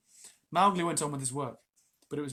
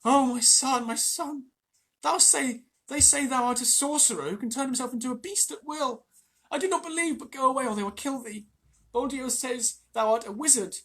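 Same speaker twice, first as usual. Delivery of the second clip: high, loud pleading mother; mother pleading with her son to run; Messua crying out; 10 semitones higher, 7 dB louder than usual. A narrator's reading. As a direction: beg him to flee, frightened for him. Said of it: "ran across to the herd and cried".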